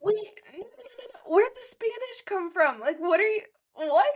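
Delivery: high, panicked no no no; shocked disbelief. Retold or imitated. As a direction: react in stunned disbelief. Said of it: "I was like".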